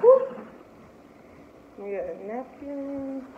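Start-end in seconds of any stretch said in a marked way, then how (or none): 0.0-0.4 startled whoop. Real, not acted. Startled by something slipping.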